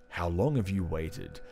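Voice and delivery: dramatic comic narration. Acted. Reading the comic aloud to his cohost, low and ominous. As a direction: read it like an ominous movie trailer. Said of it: "you should just talk in that voice".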